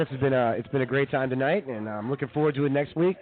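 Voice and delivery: ordinary talk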